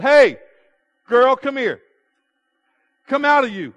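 loud, high acted rebuke; exasperated preacher's shout, acting the apostle; fed-up command. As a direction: call out sharply to cast out a demon. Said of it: "Paul one day got fed up with this girl".

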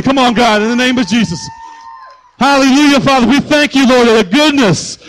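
loud, high call to worship; rally the church to shout praise; fired-up praise call. Real, not acted. Calls congregation to praise.

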